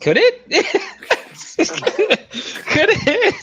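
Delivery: squeaky high-pitched mocking echo of "Could it?".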